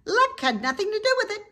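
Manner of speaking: high, fast, loud goose voice. A narrator's reading. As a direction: snap it back proudly. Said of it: "said the goose".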